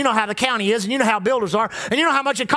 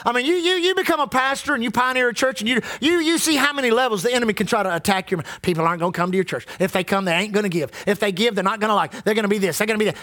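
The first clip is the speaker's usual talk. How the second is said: ordinary talk, nothing marked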